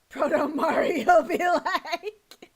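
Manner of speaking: slow, loud words through laughter; cracking up; laughing. Real, not acted. Laughing at the picture.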